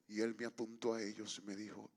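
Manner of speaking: low, soft storytelling with long pauses; hushed sermon climax. Real, not acted. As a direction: let the revelation land quietly.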